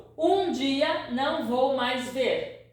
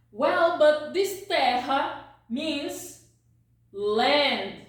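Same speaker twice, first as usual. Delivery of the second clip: ordinary talk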